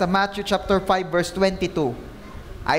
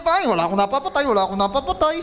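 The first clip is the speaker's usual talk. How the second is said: fast, high, cocky boasting; confident youth; 5 semitones higher, faster than usual. Retold or imitated. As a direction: brag like a sure young person. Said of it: "Yung confident na confident".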